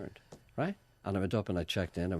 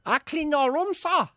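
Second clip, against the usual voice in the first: very high, loud mock cleaner; son playing hotel cleaner; 6 dB louder, slower than usual. Son knocking at parents' pretend hotel room, mock cleaner call. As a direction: call through the door in a piercingly high put-on voice. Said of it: "my son will knock on the door saying".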